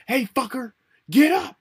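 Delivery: high loud yell, get up; yelled wake-up. Pretend. Roommate waking him by yelling, loud mock yell. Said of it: "You can yell at me all day".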